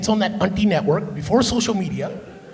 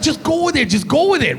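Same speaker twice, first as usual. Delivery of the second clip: exasperated auntie; 5 semitones higher than usual. Acted. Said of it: "And he's like".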